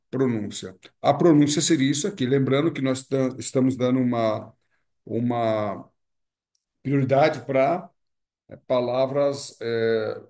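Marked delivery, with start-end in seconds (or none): none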